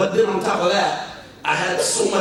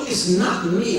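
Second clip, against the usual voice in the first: ordinary talk, nothing marked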